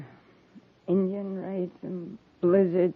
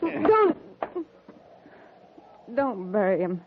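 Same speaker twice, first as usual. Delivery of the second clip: desperate plea. Acted. Widow stops them burying husband.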